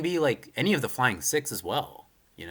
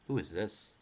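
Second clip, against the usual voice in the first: ordinary talk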